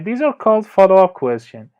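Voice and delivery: usual speaking voice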